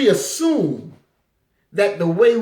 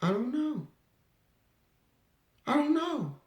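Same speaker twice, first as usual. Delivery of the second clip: cold shrug; 13 dB quieter than usual. Retold or imitated. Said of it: "And the police said".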